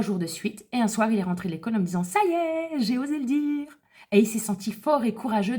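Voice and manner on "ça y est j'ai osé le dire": put-on a higher, proud little-boy voice; lightly voiced little boy. Mother retelling her son coming home from school, light little-boy voice. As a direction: let the son's pride lift the pitch.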